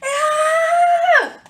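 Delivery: high loud held scream; story scream. Acted. The seed about to be eaten, high loud held scream. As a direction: let out a long panicked scream. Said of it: "I screamed and I hollered".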